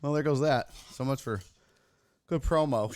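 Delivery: ordinary talk, nothing marked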